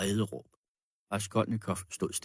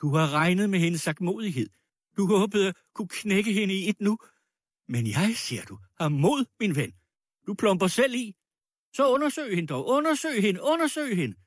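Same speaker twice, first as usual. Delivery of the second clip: high, heated challenge; enraged defiant woman; 10 semitones higher, 4 dB louder than usual. Acted. Furious woman daring her accuser to search the girl, enraged. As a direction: insist angrily, pitch climbing into shrill demands.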